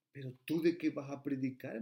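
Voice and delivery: lightly voiced mother, doubtful and incredulous; mother's doubting question. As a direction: ask it like a worried mother who can't believe her son. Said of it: "me dijo ella". (voz de madre incrédula, apenas imitada)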